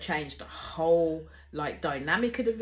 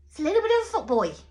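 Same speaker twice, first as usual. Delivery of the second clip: higher, louder and fast, blurting it out; half-laughing bold call, louder and higher; blurted verdict; 10 semitones higher, 6 dB louder, much faster than usual. Real, not acted. Reviewer calls out Tyreek, blurted loud and fast. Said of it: "Yeah, I said it".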